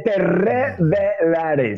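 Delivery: "revelaré" estirado y acentuado, muy lento; leé "Te revelaré" despacio, pesando "revelaré"; slow emphatic quote. Acted. Cita el versículo con énfasis. (cita lenta y solemne del versículo)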